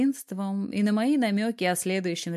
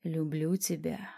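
hoarse whisper; 2 semitones lower, 7 dB quieter, slower than usual. A narrator's reading. Husband tells wife he loves her, whispering hoarsely. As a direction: murmur it softly and slowly. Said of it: "хрипло прошептал".

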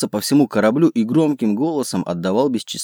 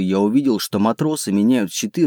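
usual speaking voice

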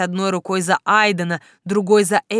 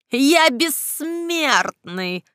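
high, slow villain shriek; 6 semitones higher, much slower than usual. A narrator's reading. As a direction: shriek it like a mad necromancer. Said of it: "взвизгнул Герин".

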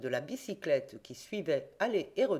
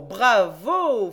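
very loud, rising ironic cheer; Fred cheers the reporter once more; louder bravo; 4 semitones higher, 11 dB louder than usual. A narrator's reading. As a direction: crown the applause with a big shout. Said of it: "fit encore le grand Fred".

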